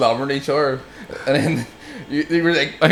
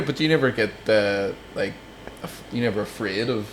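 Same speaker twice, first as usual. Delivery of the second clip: ordinary delivery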